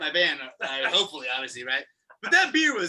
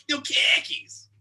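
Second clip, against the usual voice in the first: put-on Boston accent; 8 semitones higher, 3 dB louder, slower than usual. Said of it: "making fun of people with Boston accents".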